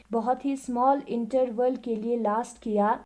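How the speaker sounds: normal talking voice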